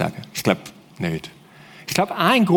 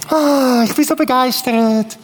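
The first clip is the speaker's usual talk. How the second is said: higher, overly gushing fake excitement; fake enthusiasm; 7 semitones higher than usual. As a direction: gush about Jesus as if you meant it, but hollow. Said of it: "wäre so eine Maske". (gespielte, übertriebene Begeisterung)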